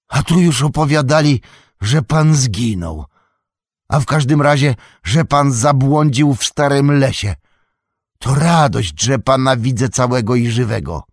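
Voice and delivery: loud, high, glad exclaiming; excited relief. A narrator's reading. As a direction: pour out the relief. Old hobbit rejoices, loud. (stary hobbit głośno cieszy się z powrotu)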